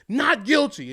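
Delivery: high, loud announcement of the verdict; retold shout. A kid announcing the verdict to class, shouting. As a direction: call it out to the room. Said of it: "He's like".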